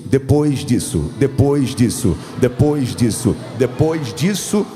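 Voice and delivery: loud, slow hammered repetition; forceful preaching. Real, not acted. Preacher promising God still uses you, emphatically. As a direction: drive each repeat home loudly and deliberately.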